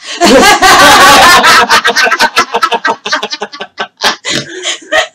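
high helpless burst of laughing; loud real laughter. Real, not acted. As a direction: crack up completely. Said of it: "I almost wet my pants laughing".

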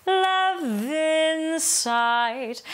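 V of "love" carried straight into "in", sung slow; sung "lo-vin sight" with a linked V. Shown as a demonstration. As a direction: join the V to "in". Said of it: "I just connect the V to the in".

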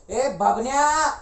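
long, loud holler of a name; calling out. Acted. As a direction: yell for a boy far away. Calls out for the boy.

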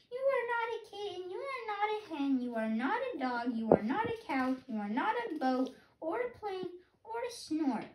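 a chick listing happily, ending tender; baby bird voice, happy. Acted. Baby bird greeting its mother, high and happy. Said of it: "my baby bird voice".

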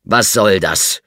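loud, sharp rebuke; snapping at him. A narrator's reading. The inspector snaps at the grinning butler. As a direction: bark the question at him. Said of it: "herrschte de Craven ihn an".

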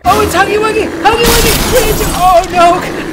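loud, high shout of surprise; excited shout. Real, not acted. Gamer spotting Huggy Wuggy, shouting excitedly. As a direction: yell his name like you can't believe it.

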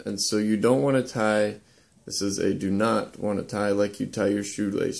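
ordinary talk

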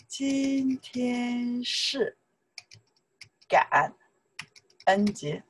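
very slow, 今天 held long and high; slow model sentence. Shown as a demonstration. Teacher modelling a new sentence, slow and drawn out. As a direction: draw it out in careful chunks. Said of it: "You can say".

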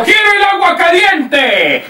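mock demanding shout. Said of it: "le digo".

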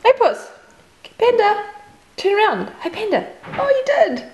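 high call to a pet. Real, not acted. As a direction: call the cat from a distance. Calling the cat across yard.

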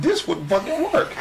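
high, excited mock realization; eureka moment. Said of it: "And I was like".

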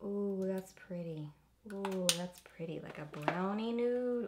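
normal talking voice

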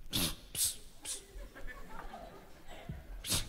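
quiet, furtive psst calls; bashful psst. Acted. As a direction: hiss shyly to get her attention. Shy guy hissing at a woman.

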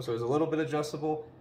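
ordinary talk

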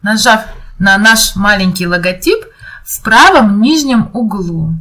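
ordinary talk, nothing marked

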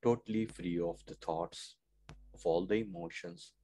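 very soft, low meditation voice; hushed meditation. Acted. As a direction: lead them gently into silence, low and hushed.

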